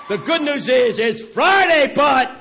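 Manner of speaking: shouted, high call to the crowd; preacher calling the crowd to shout Sunday's coming; call and response. Real, not acted. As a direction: rouse them to answer back. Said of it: "So I want to hear it".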